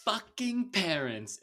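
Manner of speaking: high, loud, sing-song drawn-out mimicry; mocking imitation. Podcaster mocking Jordan's gun scene, high sing-song imitation. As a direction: mimic an actress's over-dramatic swearing. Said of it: "She goes".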